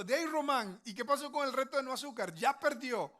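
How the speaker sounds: put-on playful scolding at the caught kid; mock scolding surprise. Father catches son eating cookie, mock scolding.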